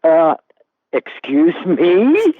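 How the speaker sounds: indignant disbelief. Retold or imitated. Paul Newman answering the fan at the urinal, outraged.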